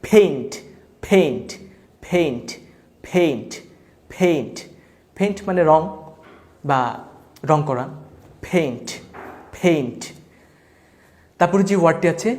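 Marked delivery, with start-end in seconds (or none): 0.0-4.6 "ফেইন্ট" with a puff of air on the p; aspirated P. Shown as a demonstration. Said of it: "ফয়ের মতো উচ্চারণ হবে". 8.5-10.0 "ফেইন্ট" with a puff of air on the p; aspirated P. Shown as a demonstration. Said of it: "ফয়ের মতো উচ্চারণ হবে".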